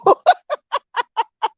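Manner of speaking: high, rolling laugh; amused laughter. Real, not acted. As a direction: burst out laughing at the story. Laughs at sister's remark.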